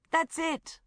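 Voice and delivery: high, loud burst of excitement; excited. A narrator's reading. Tom hitting on the answer among friends, high and excited. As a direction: blurt it out thrilled. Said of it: "said Tom excitedly".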